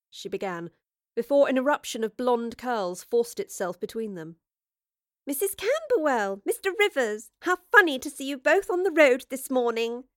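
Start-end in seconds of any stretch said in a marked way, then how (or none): 5.3-10.1 put-on higher, nasal young lady gushing; bright nosy chatter, pitched up; nasal gossip. Grace interrupting Jane and Rivers, pitched-up nasal gush. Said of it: "The nasal tones belonged to Grace Blackstone".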